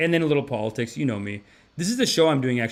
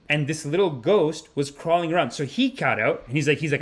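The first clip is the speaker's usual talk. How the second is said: ordinary talk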